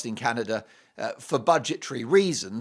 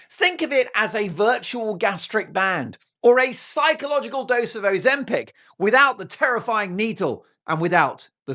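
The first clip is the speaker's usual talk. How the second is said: bright, louder sponsor read; 5 semitones higher, 6 dB louder than usual. Acted. Host reading a sponsor ad to viewers, upbeat ad read. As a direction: sell the app with upbeat energy.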